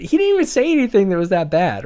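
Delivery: indignant, a bit worked up; salty. Real, not acted. Annoyed defense of writer.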